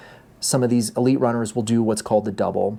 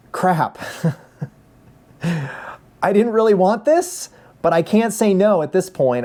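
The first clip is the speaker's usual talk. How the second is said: half-laughing, slow, higher dismay; 5 semitones higher, slower than usual. Retold or imitated. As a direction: chuckle through the panic.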